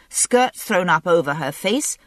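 usual speaking voice

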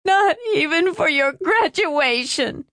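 high, tearful lament; distraught high-pitched crying voice; sobbing. A narrator's reading. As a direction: keep wailing through the sentence. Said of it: "she sobbed into her hands".

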